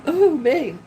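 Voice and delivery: words stretched by a yawn. Real, not acted. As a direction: say it mid-yawn. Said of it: "I keep yawning".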